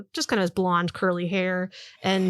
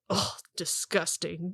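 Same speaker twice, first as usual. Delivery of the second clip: disgusted scoff; 7 dB quieter, slower than usual. Acted. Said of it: "He's very frustrated".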